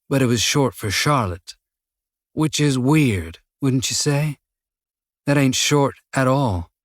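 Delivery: slow, musing Texan drawl, toying with his captive; a hint of Texas, lazily taunting; slight Texan drawl. A narrator's reading. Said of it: "a slight Texan drawl to his voice".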